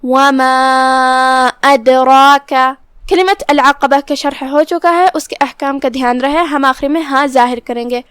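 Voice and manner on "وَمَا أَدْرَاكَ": أَدْرَاكَ with a full, heavy ra and a heavy long ā, slow. Shown as a demonstration. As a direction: recite slowly with a bouncing dal and a heavy ra. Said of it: "تو ہم پڑھیں گے". (را کو بھاری آواز میں پڑھتے ہوئے)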